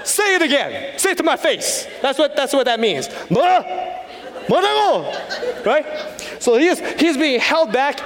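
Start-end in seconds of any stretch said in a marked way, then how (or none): 0.0-1.9 high-pitched confrontational shout, played for laughs; angry yelling. Translating the angry elder's challenge, mock angry yelling. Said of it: "he was yelling at this other guy". 3.3-5.1 angry challenge, voice jumping high; angry Korean. Shown as a demonstration. Showing what you'll hear from an angry Korean, angry outburst. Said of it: "if a Korean person is angry".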